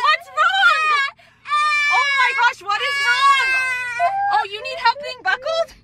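flustered. Real, not acted. Babies fuss while being buckled.

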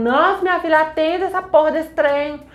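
acted-out urgent phone plea. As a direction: urge her frantically to pick up. Kids urgently phoning Nancy.

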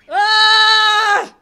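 long, high, loud yell; playful frustrated scream; angry scream. Real, not acted. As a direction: let out a sustained cry of rage. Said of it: "I'm angry".

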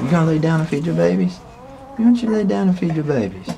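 fast, high coaxing voice; high pet voice. Real, not acted. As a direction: coax the dog in a high sing-song. Coaxing mother dog, pet voice.

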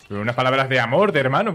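normal talking voice

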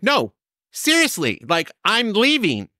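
high, drawn-out insistence; insistent protest. Retold or imitated. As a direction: retell an indignant protest, stretching key words. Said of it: "getting madder and madder".

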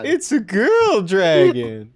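loud, high, stretched-out exclamation; drawn-out playful shout. Pretend. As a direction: belt it out slowly and high. Shouted joke reveal about the dragon.

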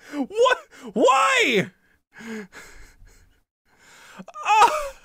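high, loud broken wails; crying out in grief; real sobbing cries; tearful cry. Real, not acted. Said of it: "I'm tearing up".

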